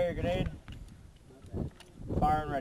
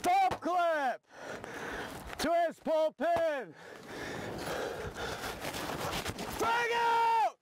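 high shouted drill callouts; grenade warning yell; 7 semitones higher, 15 dB quieter, much slower than usual. Real, not acted. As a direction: shout each step slow and high.

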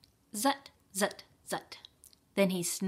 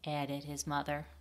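plain reading voice

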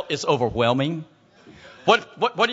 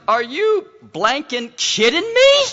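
high, slow, louder disbelief; mock incredulity; 7 semitones higher, 5 dB louder, slower than usual. Gang leader answering a church invitation, mock incredulous. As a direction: stretch it out as if appalled by the offer.

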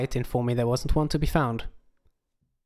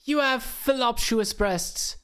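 higher, slow mock-desperate begging; pleading; 10 semitones higher, slower than usual. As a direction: grovel for mercy, hammed-up. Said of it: "he pleaded on the ground".

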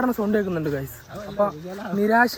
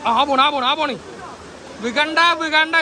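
calling out; 5 semitones higher than usual. Real, not acted. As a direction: shout across the stream. Calling out in water.

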